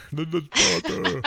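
laughing while copying the voice; laughing echo. Pretend. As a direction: repeat the line through a laugh. Echoing the bit, laughing.

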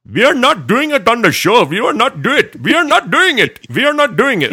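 very high, loud mock Indian accent. Host imitates himself ranting alone in the car, mock Indian accent. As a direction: yell it in a sing-out, high-pitched Indian accent. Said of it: "broke out in this Indian voice".

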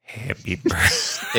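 slow, drawn-out strange voice; Ding Pong voice. Pretend. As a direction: stretch it out like a strange character. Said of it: "in the voice of Ding Pong".

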